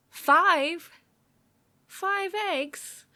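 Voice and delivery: loud, high outraged squawk at the number; shocked disbelief. Real, not acted. Reacting to the recipe asking for five eggs, loud indignant shock. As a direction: blurt it out like the recipe is a scam.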